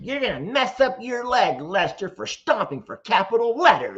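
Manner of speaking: high, hammed-up emphatic reading; all-caps voice. Streamer repeating a comment about stomping, mock shouting. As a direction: act out the capitals, punching each word. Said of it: "they're in all caps".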